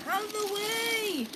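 high, drawn-out warning call; cried warning. A narrator's reading. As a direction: call out high to clear the track. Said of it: "cried Edward".

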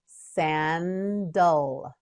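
"Sandal" slowly as san, dul; syllable by syllable. Shown as a demonstration. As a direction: stretch both syllables of "Sandal". Teacher models word by syllables.

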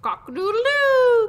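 loud, high cock-a-doodle-doo; rooster crow. Acted. Storyteller voicing Rooster spotting Turkey, loud crow. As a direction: crow it out, soaring and held. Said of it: "crowed Rooster".

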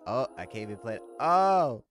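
hammed-up squeal of surprise; mock panic, rising to a yell; mock flustered cry. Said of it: "You gotta be like".

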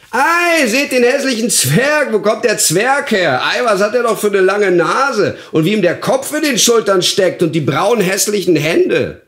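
high, loud calls of a gawking crowd; jeering street shouts. A narrator's reading. Onlookers gawking at an ugly dwarf, high jeering shouts. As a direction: shout it out, far above your normal pitch. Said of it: "Überall hörte er rufen".

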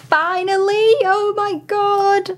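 high, drawn-out squeal of delight; thrilled. Real, not acted. Fan unwraps the album that completes a collection, thrilled. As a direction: squeal it out as if the wait is over. Finds a long-wanted album.

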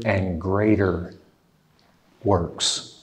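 hushed weight on greater works; slowed emphasis. Real, not acted. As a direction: lean in and let it sink.

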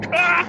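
sudden high-pitched "ah"; short yelp. Real, not acted. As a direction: let out a quick, high yelp. Startled during self-portrait attempt.

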